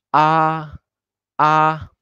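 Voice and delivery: high, level "ah"; tone one. Shown as a demonstration. Teacher repeats tone one in Spanish lesson, high flat "ah". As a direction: hold "ah" steady on a high pitch. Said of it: "más alta y pleno".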